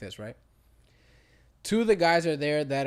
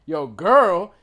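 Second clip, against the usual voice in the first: mock call across room; 4 semitones higher, 13 dB louder than usual. Guy calling across the room.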